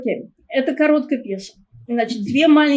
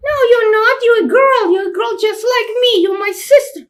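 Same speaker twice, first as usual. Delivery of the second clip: much higher and louder, taunting; a kid sneering at her sister; little girl's voice; 10 semitones higher, 5 dB louder than usual. Acted. Little sister mocking the jealous older sister, high child voice. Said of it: "children's voice".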